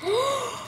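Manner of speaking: high, soft gasp of delight; sudden thrilled intake of breath. Real, not acted. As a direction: gasp as if you just won. Gets the one she wanted.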